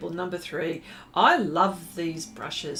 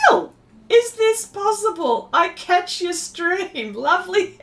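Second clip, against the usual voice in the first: high, loud, laughing greeting; thrilled high laughing welcome to a friend; delighted surprise; 11 semitones higher, 7 dB louder than usual. Real, not acted. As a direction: squeal with joy at a friend showing up. Surprised a friend joined stream.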